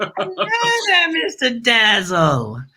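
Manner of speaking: slow, laughing hello; laughing greeting. Real, not acted. Host greets his guest slowly, laughing. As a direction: stretch the welcome through a laugh.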